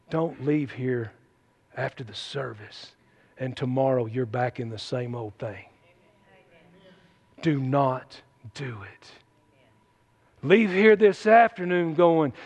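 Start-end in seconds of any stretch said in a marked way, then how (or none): 0.1-9.1 earnest warning. Real, not acted. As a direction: urge them gravely in a lowered, softened voice. Grave plea to the congregation.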